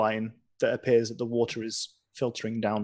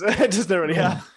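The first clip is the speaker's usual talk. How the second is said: laughing; 8 semitones higher, 6 dB louder than usual. Real, not acted. Joking laughter at table.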